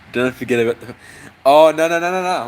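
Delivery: normal talking voice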